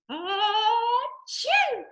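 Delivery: high, drawn-out fake achoo; pretend sneeze. Shown as a demonstration. Somebody sneezed in the leaves song, pretend sneeze. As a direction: wind up and sneeze playfully. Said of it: "somebody sneezed".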